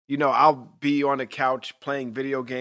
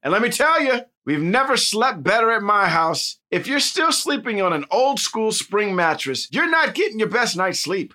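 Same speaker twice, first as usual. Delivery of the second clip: bright sponsor read; 4 semitones higher than usual. Acted. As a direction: sell the mattress.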